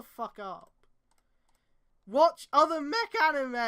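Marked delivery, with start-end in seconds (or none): 2.1-3.5 higher, loud, slowed demand; loud slow exasperated plea; exasperated shout. Real, not acted. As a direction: yell the advice at the author. Yelling advice at the author.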